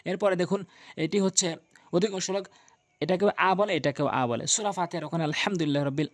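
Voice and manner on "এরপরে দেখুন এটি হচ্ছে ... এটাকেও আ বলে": ordinary talk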